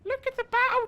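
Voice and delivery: put-on squeaky kid voice, very high; a little kid calling out in excitement; high child voice. Child voice take.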